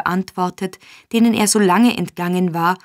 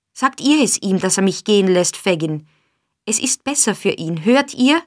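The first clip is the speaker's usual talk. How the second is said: ordinary delivery, nothing marked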